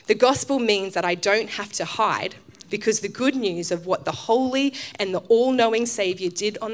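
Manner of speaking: reading aloud. Acted. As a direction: read it out with conviction to the church. Reading a quoted passage aloud.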